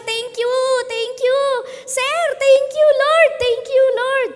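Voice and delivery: high, loud, slow cries of thanks; shouting thanks. Acted. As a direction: call it out like a grateful leper. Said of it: "nagsisisigaw lang siya". (malakas na sigaw ng pasasalamat)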